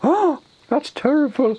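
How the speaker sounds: high, slow, loud gasp of horror; mock shock. Ogre learning of the planned attack, shocked. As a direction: gasp in hammed-up dismay.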